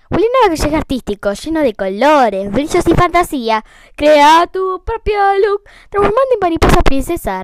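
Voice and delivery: loud, high, excited commercial voice; ad announcer. Retold or imitated. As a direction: sell it like a kids' toy ad. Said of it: "el comercial de Juliana".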